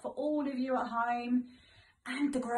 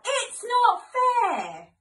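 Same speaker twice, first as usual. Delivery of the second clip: much higher, much louder, slow stomping tantrum yell; angry shout; 12 semitones higher, 10 dB louder, much slower than usual. Acted. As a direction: roar it like a furious child. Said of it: "stomp and roar and shout".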